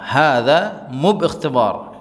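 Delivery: drawn-out, careful repetition; very slow repeat. Shown as a demonstration. Teacher repeats the Arabic phrase very slowly for students. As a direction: stretch every syllable for learners. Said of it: "slow repeat for students".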